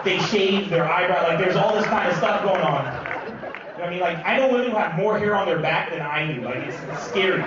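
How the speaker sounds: ordinary talk, nothing marked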